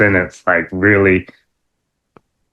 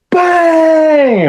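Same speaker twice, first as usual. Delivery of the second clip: much higher, loud Bang shout; shouted catchphrase; 3 dB louder than usual. Retold or imitated. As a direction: belt the Bang like the wrestler. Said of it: "he goes".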